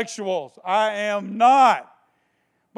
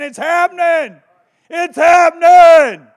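excited; 9 semitones higher, 15 dB louder than usual. Real, not acted. Rising preacher excitement.

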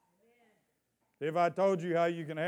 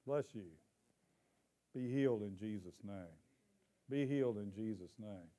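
quiet prayer; 6 semitones lower, 7 dB quieter than usual. Real, not acted. Prays for healing quietly.

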